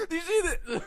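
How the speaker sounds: high, loud laughing cry; a fan pointing it out to a friend, high and laughing; excited disbelief. Retold or imitated. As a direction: squeal it at a friend. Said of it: "fan reaction retold".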